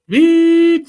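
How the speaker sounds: long high beep held flat and loud; imitated flatline beep. Retelling the patrol's lost radio, a long high flatline beep. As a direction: make the heart monitor flatline.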